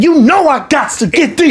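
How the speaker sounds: high, put-on excited voice; high-pitched, overjoyed declaration; excited shopper imitation. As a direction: mimic a shopper who must have them.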